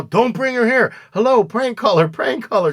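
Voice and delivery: high, loud, jumpy voice picking up the phone; mock paranoid phone answer. Dealer answering a late-night call, high and loud.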